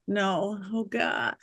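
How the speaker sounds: soft, sinking dismay; dismayed mutter. Retold or imitated. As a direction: say it under your breath, defeated. Looking at empty calendar.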